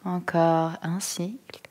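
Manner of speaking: calm, unhurried yoga nidra voice, long pauses; guided meditation. Acted. Yoga teacher settling listeners into stillness, calm guided-meditation voice. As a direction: guide them gently into stillness, phrase by phrase.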